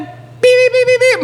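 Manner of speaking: very high, loud electronic beeps; piercing beep-beep alarm noise; imitated beeping. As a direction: squeal out rapid high beeps like a machine. Imitates the alert for his name.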